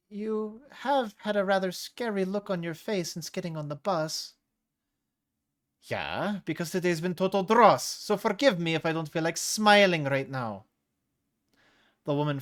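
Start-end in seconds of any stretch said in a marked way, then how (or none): 0.1-4.3 higher, gentle-condescending neighbor; older woman voice. Acted. The neighbor urging Salatra to move, higher and patronising. 5.8-10.7 louder, higher exasperated retort; snapping back. Acted. Salatra to her neighbor, louder exasperated retort.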